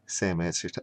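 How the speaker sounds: plain reading voice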